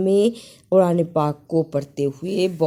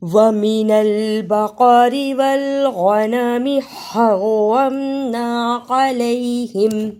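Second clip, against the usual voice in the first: slow melodic chanted recitation; Quran recitation; 5 semitones higher, slower than usual. Acted. Teacher recites the verse before the lesson, chanted. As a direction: recite it in measured tajweed chant.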